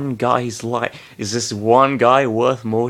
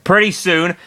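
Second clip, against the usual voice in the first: usual speaking voice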